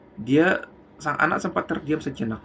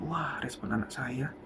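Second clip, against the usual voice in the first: inner thought, softly; 2 semitones lower, 7 dB quieter than usual. Acted. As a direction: muse quietly to yourself. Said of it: "dalam hatinya".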